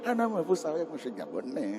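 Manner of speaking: ordinary talk, nothing marked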